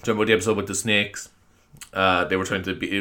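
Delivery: normal talking voice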